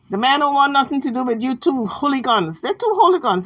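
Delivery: normal talking voice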